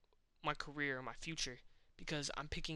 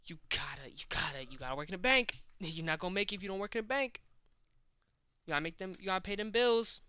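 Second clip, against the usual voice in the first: louder, higher, pushy lecture; loud scolding inner voice; 4 semitones higher, 6 dB louder than usual. Retold or imitated. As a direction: yell it like harsh reality. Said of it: "reality keeps just smacking me in the back of the head like".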